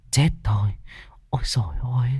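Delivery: breathless frightened whisper; panicked whisper. Acted. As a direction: hush it out in dread. (thì thào hoảng hốt)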